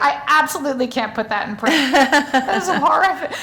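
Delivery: laughing, half-horrified protest; laughing in shock. Retold or imitated. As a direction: laugh through the refusal, appalled. Reacting to Jake's shocking insult.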